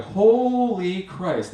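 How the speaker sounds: slow, high stunned "Holy Christ"; stretched awed gasp. Retold or imitated. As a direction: draw it out in awe at the dark. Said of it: "awe at dark cliff".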